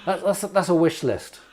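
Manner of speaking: usual speaking voice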